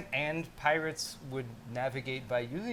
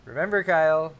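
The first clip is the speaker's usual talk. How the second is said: louder knowing nudge; louder teasing prompt; 2 semitones higher, 9 dB louder than usual. Real, not acted. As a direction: remind him loudly. Prompting co-host about segment.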